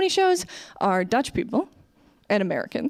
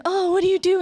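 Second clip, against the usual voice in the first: eager gushing question; 10 semitones higher, 4 dB louder, faster than usual. Retold or imitated. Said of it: "I was always like".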